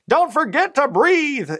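loud, high, drawn-out holler; coach's yell. Retold or imitated. Coach to cross-country runners mid-race, yelling. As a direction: imitate a coach yelling at his runners, stretched out. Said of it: "he used to yell at us".

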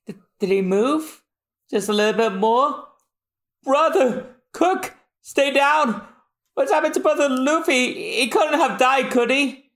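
high, anxious pleading; high worried cartoon voice; high worried sidekick. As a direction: fret over Luffy. Voicing Johnny, worried.

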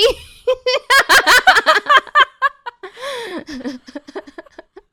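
high loud burst of laughter; laughing at her own punchline. Real, not acted. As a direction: crack up hard.

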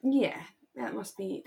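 usual speaking voice

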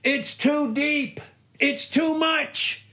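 very high, drawn-out mock whine; mock complaining voice. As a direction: stretch each word in a high put-on voice. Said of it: "Whenever you hear the voice".

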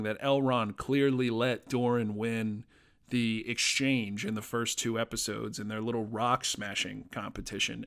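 normal talking voice

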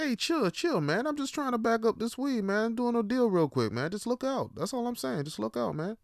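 high-pitched hushed dealer, hurried and sneaky; a high, hushed drug dealer begging a favour; hushed dealer voice on the bus. Retold or imitated. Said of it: "It's like".

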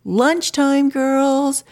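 put-on high, loud call to the kids; mom calling out. Mom calling the girls in for lunch, loud call. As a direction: yell it across the yard like a mom. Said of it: "My mom screams out".

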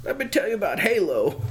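put-on high creaky old-timer voice; old prospector. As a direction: boast like an old geezer. Mocking old gamer.

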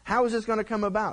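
mock impatient king, fast and pushy; impatient demand. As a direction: rush the questions impatiently. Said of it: "Ahab turns around and goes".

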